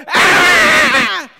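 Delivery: very loud, high, drawn-out yell; sports-fan scream. Shown as a demonstration. Preacher demonstrates a fan's cheer at the game, screaming. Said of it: "that was gonna be that loud".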